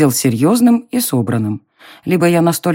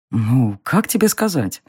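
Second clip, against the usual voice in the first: slow, low, softer teasing drawl; mocking drawl; 4 semitones lower, 5 dB quieter than usual. A narrator's reading. Darren teases the heroine, slow mocking drawl. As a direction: stretch it out with a smirk. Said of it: "протянул он насмешливо".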